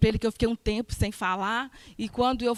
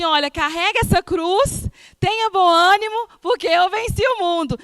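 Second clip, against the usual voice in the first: higher, louder, rising preacher's call; God's encouragement proclaimed, higher and louder; fervent preaching; 6 semitones higher, 5 dB louder than usual. Acted. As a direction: lift the church with God's promise. Preaching God's words at full force.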